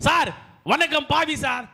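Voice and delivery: ordinary talk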